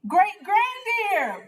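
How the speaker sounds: put-on high, slow, loud child's call. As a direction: call across the park to great-grandma. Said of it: "Buddy called".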